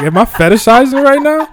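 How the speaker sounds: high, loud mock alarm; self-questioning. Comic asks themself whether the accent is a fetish, mock alarmed. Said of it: "You know, it's like".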